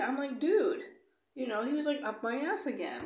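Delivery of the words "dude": a sharp, high, loud "Dude"; high, loud exasperated snap at a pushy passenger. Retold or imitated. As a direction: call him out, fed up.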